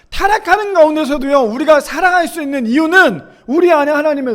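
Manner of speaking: high, urgent pulpit voice; impassioned preaching. Real, not acted. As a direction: preach it at full force to the congregation. Preaches at full force.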